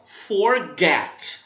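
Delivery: forget drawn out slowly, landing on GET; stress on GET. Shown as a demonstration. Teacher showing where stress falls, forget with GET stressed. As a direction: lean hard on the second syllable of forget. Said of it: "o get é a sílaba tônica".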